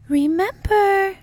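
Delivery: very high, loud, drawn-out; sing-song it slowly up high; hammed-up lovey farewell. A narrator's reading. Dana to Mulder at parting, lovey.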